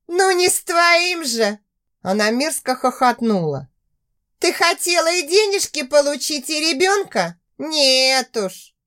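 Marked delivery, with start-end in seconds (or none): 0.1-1.6 mocking. A narrator's reading. Zhanna mocks her sister. 4.4-8.7 spiteful woman. A narrator's reading. Zhanna taunts her sister.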